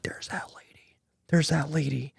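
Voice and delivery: hushed gossip, barely voiced; very soft retold whisper; imitated whispering. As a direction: murmur it like onlookers in a lobby. Lobby crowd murmuring about mom.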